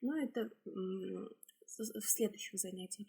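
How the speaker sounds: normal talking voice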